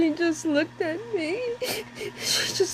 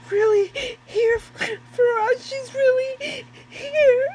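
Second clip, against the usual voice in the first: higher, tearful insistence, voice cracking; crying plea; 4 semitones higher than usual. Real, not acted. Mother telling her son their dead daughter is there, crying.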